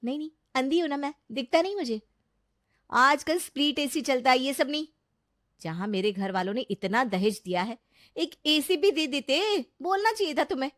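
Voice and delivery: high, sarcastic bridal tirade; loudly complaining bride. Acted. New bride rails at husband over dowry and AC, complaining. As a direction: pitch it up and scold the husband. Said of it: "इतना जोर जोर से बोल रही हो".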